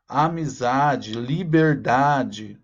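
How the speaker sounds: amizade, liberdade drawn out slowly; slow rhyme drill. Shown as a demonstration. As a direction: stretch the -dade endings. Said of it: "como ele vai combinando". (devagar, destacando a rima em -dade)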